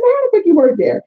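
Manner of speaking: high, loud incredulous demand; mock fury. Retold fight with manager.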